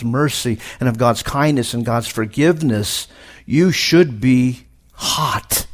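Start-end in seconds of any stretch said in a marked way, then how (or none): 3.5-5.7 very slow, voice catching; pleading with the church, very slow and tearful; slow, near crying. Real, not acted. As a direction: drag each word out, close to tears. Preacher pleads his point.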